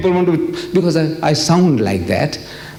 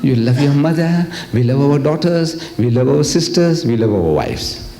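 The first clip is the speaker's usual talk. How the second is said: ordinary delivery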